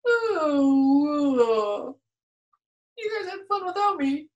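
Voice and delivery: a sulky bear blubbering. Acted. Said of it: "bear blubbers on".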